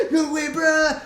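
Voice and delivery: high, loud mock squeal; squeaky voice. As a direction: replay the voice slip as a loud squeak. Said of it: "what happened to my voice there".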